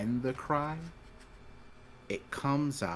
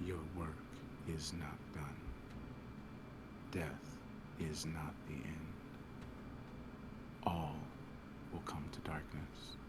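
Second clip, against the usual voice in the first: deep gravelly pit fiend; 5 semitones lower, 15 dB quieter than usual. Acted. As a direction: breathe it like a fiend from below. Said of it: "as you hear a deep voice say".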